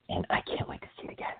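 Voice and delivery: quick excited whisper under the breath; fast giddy whisper to herself. Real, not acted. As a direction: let it slip out as a hushed secret. Excited aside about Aquaman.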